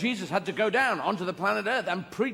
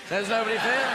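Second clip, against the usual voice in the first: mock echo; 4 semitones higher, 6 dB louder than usual. Mocking the settlers' claim.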